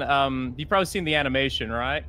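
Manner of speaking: usual speaking voice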